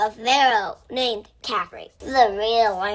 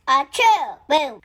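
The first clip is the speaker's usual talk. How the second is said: fake sneeze; 3 semitones higher, 3 dB louder than usual. Acting out the Sphinx sneezing.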